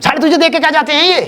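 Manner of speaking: retelling dad's outburst at the losing team, shouted; imitating angry father. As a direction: rant at the TV like a furious dad. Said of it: "पापा मेरे ही रैप्टा धरने को हो रहे हैं".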